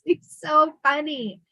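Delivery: ordinary talk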